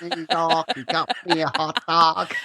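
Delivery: clumsy high American accent attempt; hammy American accent, half-laughing; bad American accent. Shown as a demonstration. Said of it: "I'm just terrible at accents".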